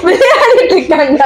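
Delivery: high voice through laughter; giggly, breaking-up delivery; real laughing. Real, not acted. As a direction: talk while cracking up, words stretched. Cracks up at the banter.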